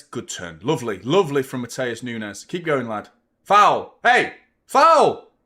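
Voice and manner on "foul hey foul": loud, high call of foul; shouting foul. Real, not acted. Appealing to the referee, loud shout.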